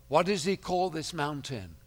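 ordinary delivery

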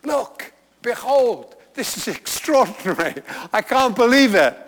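high, loud burst of wonder; amazed exclamation. Retold or imitated. Matthew telling his readers, amazed and loud. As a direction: cry out in astonishment. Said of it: "Matthew says".

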